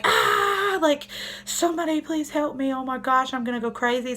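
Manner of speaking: a mother at the end of her rope crying out; desperate cry for help. Retold or imitated. Overwhelmed mom with screaming baby, frantic high wail. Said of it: "I'm like".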